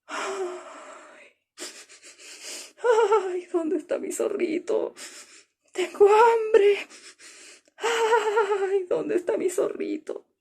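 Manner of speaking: high, plaintive hungry moan; sad whining condor. Acted. The hungry condor searches the peaks, sad and whining. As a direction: lament for lost food. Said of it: "se empezó a poner triste".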